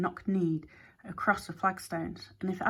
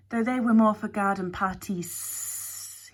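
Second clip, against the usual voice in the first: ordinary delivery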